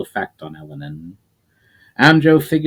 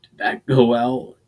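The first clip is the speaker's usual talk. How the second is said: a light, strained croak; injured fighter lightly croaking a question. Acted. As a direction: croak it out through the pain, lightly. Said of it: "croak out".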